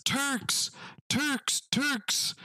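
high, alarmed call of Turks; alarmed shouting narrator. Retold or imitated. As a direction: cry out the warning, pitched high. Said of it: "some idiot historical narrator guy out there says".

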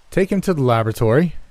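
commanding. A narrator's reading. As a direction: give the order quickly and with authority. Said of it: "he said in a commanding voice".

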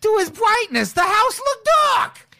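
lightly voiced Brooklyn guru; brooklyn accent. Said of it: "thick Brooklyn accent".

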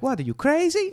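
reacting to an order of extra garlic, high-pitched pizza man. Pizza man scoffing at the garlic customer, incredulous. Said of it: "it's a pizza man".

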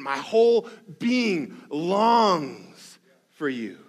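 psalm line read with grit and yearning; gritty longing. Acted. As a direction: squeeze the longing out of every word. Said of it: "the grit that comes with that statement".

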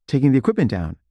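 usual speaking voice

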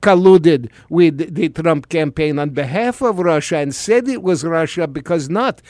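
lightly put-on Russian accent, mock Putin; a hint of Russian accent, playing Putin for laughs; Putin impression. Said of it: "thank you, President Putin".

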